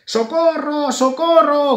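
high, loud cries of alarm; screaming for help. A narrator's reading. Grisela cries out while running from the bees, panicked shout. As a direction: yell it as a fleeing little mouse. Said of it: "gritaba Grisela sin dejar de correr".

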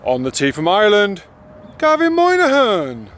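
loud high hammed-up starter call; grand announcer. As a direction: stretch out the name like a showman. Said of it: "I could be like".

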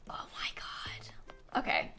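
breathless whispered awe. Real, not acted. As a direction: whisper it in disbelief. Awed aside after resub.

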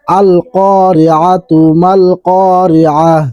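"আল কারিআতু" read through without stopping into "মাল", slow; connected reading. Shown as a demonstration. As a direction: carry "আল কারিআতু" on, no pause before "মাল". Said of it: "তাহলে তা উচ্চারণ হবে". ("আল কারিআতু মাল" না থেমে টেনে)

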